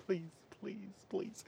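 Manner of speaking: soft begging whisper behind his back; pleading whisper. Retold or imitated. Cameraman silently begging the director to take the job, hushed pleading. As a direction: mouth please over and over, hushed.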